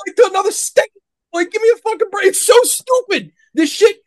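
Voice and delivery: high-pitched, incredulous outburst; fed up and ranting high; exasperated rant. Real, not acted. Exasperated rant about a film's plot.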